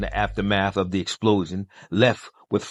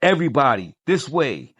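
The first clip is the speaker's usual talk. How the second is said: yell; 6 semitones higher than usual. A narrator's reading. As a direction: call everyone toward the exit. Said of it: "Nightwing yells out".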